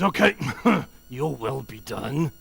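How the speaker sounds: laughing, offhand resigned okay; said with a dismissive laugh; grudging. Shown as a demonstration. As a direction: shrug it off with a laugh. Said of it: "The first way to say is".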